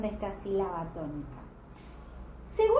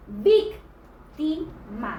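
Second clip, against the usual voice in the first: "víctima" very slow, loud "víc", syllables apart; stress on first syllable; 4 dB louder, much slower than usual. Shown as a demonstration. Teacher giving an esdrújula, slow syllabled "víctima" stressed on "víc". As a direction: break up "víctima", punching "víc". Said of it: "en la antepenúltima posición".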